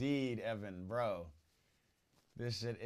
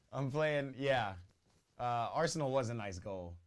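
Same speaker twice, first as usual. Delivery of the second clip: ordinary talk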